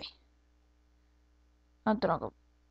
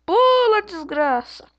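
high furious shout; raging gamer; 9 semitones higher, 6 dB louder, much slower than usual. Real, not acted. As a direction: scream the jump at the screen. Yelling at character to jump. (gamer furioso gritando)

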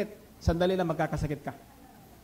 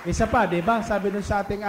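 fervent preaching; 4 semitones higher than usual. Acted. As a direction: drive the promise home to the congregation. Preaching at full force.

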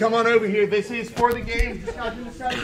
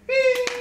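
buzzer sound; 4 dB louder than usual. Acted. Imitating a wrong-answer buzzer.

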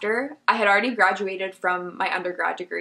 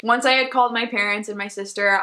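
ordinary delivery

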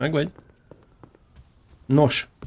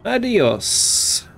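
echoing the villain; 6 semitones higher than usual. Retold or imitated. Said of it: "Adios, asshole (game clip echoed)".

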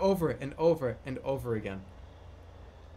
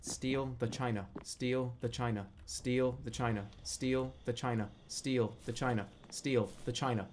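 softer, steady mantra, each line the same; quiet deliberate repeating; 5 semitones lower, 6 dB quieter than usual. Shown as a demonstration. Player muttering the task to himself, softer chant. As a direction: murmur it like a grocery list to remember. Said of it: "Just saying it so deliberately".

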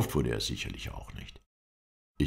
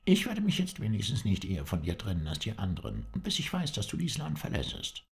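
higher-pitched, insistent character voice; earnest companion; 7 semitones higher than usual. A narrator's reading. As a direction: speak up as a companion who refuses to part.

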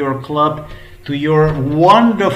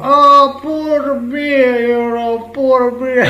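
mock whining; 9 semitones higher, slower than usual. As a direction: mimic a whiny complainer begging for sympathy. Mocking whining about complaints.

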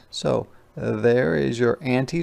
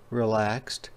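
ordinary delivery, nothing marked

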